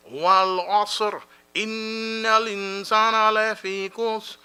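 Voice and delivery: chanted Arabic recitation, steady and sustained; Quran recitation. Acted. Chaplain closing the gathering with recited dua. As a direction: recite it with devotion to close.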